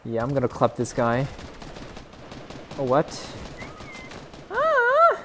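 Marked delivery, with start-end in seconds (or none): 4.5-5.2 very high, thin startled cry; high falsetto yelp. Real, not acted. Player reacts to shock in game, falsetto yelp.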